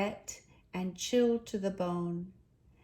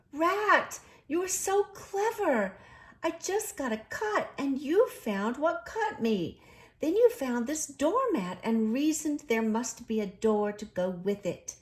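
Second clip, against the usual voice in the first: high, louder gushing praise; high excited delighted praise; admiring; 6 semitones higher, 4 dB louder than usual. A narrator's reading. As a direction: gush with admiration. Mole admiring the rat.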